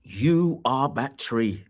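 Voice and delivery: "you are that tree", slow, with the weight on "you"; slow pronouncement. Acted. Daniel tells the king, slow weighty pronouncement. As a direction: lay it on the king slowly.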